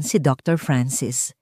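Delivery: usual speaking voice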